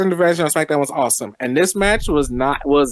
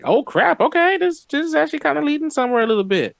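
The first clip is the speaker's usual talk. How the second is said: mock surprise; 12 semitones higher than usual. Sudden realization, retold reaction.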